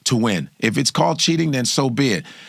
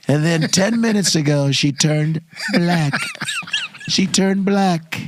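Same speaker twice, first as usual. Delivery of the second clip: Trump impression; 3 semitones higher than usual. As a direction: do Trump puzzling over Kamala. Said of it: "'Cause he goes".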